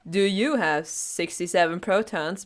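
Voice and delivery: normal talking voice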